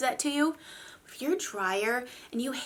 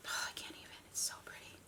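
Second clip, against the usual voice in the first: whispering; 20 dB quieter, faster than usual. Real, not acted.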